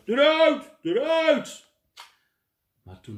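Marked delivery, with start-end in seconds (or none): 0.1-1.7 loud high repeated shouts; stubborn shouting. Acted. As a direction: keep yelling it stubbornly. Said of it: "bleef koppig roepen". (koppig en luid roepen)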